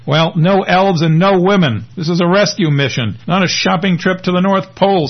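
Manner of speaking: protest, knowing you will lose; desperate. Acted. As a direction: Lance pleading with Mary in mock desperation. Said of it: "says Lance desperately".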